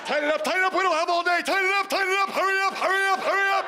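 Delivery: high, loud drill sergeant orders; loud gung-ho soldier. Retold or imitated. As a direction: bark it like a drill instructor. Ordering guests to hurry.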